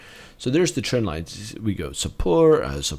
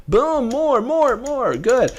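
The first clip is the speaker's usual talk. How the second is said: excited; slower than usual. Real, not acted. Excited chart commentary.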